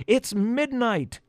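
high, drawn-out warning cry; yelling. A narrator's reading. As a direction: call the alarm slowly, pitched high. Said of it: "he yelled".

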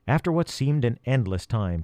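plain reading voice

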